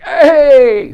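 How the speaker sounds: high, loud, drawn-out holler; over-the-top greeting. Shown as a demonstration. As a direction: greet them far too big, comically. Wrong way to greet clients.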